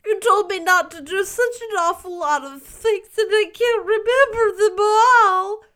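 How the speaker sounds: put-on high, loud childish protest; whining small boy. A narrator's reading. Davy protests to Marilla, high whining boy. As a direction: plead like a caught little boy.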